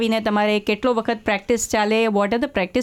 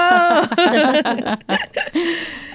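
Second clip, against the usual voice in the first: delighted laugh; 8 semitones higher than usual. Real, not acted. Reacts to the song.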